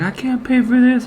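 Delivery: imitated crying. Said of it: "And he started crying".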